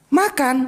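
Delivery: "Makan." as a firm, falling command; commanding tune. Shown as a demonstration. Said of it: "lain tekanan, lain arti".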